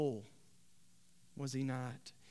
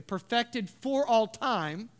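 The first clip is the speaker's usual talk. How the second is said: "perfected for all time" slow and raised in pitch; emphatic; 7 semitones higher, 5 dB louder, slower than usual. Acted. Preacher showing the verse's thrust, "perfected for all time" said emphatically and slowly. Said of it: "he adds it emphatically".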